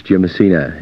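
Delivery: ordinary talk, nothing marked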